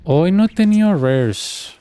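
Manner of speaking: sing-song slow complaint; drawn-out high. Pretend. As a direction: stretch it out up high as if announcing. Says the same line twice.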